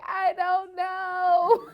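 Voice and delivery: loud, high, drawn-out comic wail; mock crying. Crying on the phone to mom, mock wailing. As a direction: wail it through laughter, very stretched. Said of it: "I'm literally just crying on the phone".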